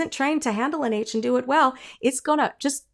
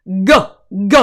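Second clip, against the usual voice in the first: short firm glottal g clicks; glottal g sounds; 3 semitones lower, 9 dB louder than usual. Shown as a demonstration. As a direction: pop a hard g on the cords. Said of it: "Try it".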